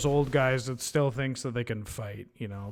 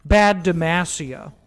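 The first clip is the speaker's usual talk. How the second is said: mock scolding; 3 semitones higher, 9 dB louder, slower than usual. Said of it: "scolding a card region".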